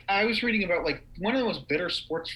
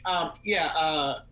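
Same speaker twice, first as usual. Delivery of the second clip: usual speaking voice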